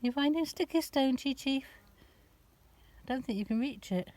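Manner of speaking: high, soft and slow talk to the cat; soft pet talk. Real, not acted. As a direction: murmur gently to the cat. Talking softly to her cat.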